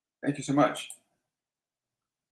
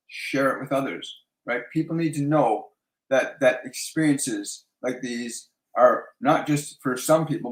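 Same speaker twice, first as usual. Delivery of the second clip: ordinary talk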